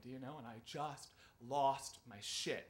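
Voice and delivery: ordinary talk, nothing marked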